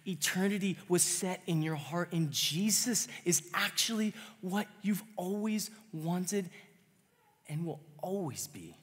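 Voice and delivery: high, loud preaching climax; impassioned pulpit cry; preaching at full force. Real, not acted. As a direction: push it out with fervour, building.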